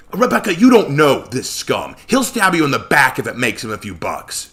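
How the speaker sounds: loud mock snarl; a lightly voiced snarl. Joshua snarling a warning to Rebecca about the scum. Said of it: "Joshua snarled".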